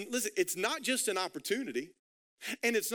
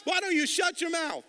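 loud, high rebuke; angry shout; 7 semitones higher, 3 dB louder than usual. Retold or imitated. Criminal shouting down the mocking criminal, angry shout. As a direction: snap at him to shut up. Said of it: "Philip D's translation".

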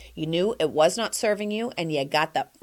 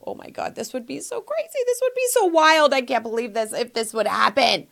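excited gush; 4 semitones higher than usual. Retold or imitated. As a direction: gush like a dream came true. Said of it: "You go".

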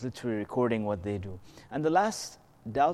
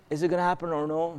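usual speaking voice

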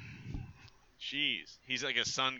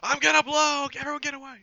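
panicked shout; 8 dB louder than usual. Acted. Player mock-panicking, shouting warning.

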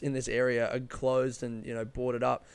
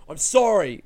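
loud, raised-pitch apology; defensive; 4 semitones higher, 7 dB louder than usual. Real, not acted. Apologising for the accent he just did, loud and defensive. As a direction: burst out with a defensive sorry. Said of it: "Fuck, I'm so defensive".

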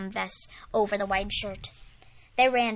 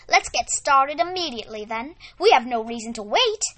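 louder, keen call to start; rally them to begin right now; eager exclamation; 3 semitones higher, 7 dB louder than usual. Acted. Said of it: "Miss Rosie exclaimed".

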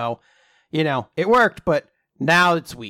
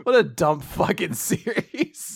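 laughing; 3 semitones higher, 3 dB quieter, slower than usual. Real, not acted. Repeats the phrase, laughing.

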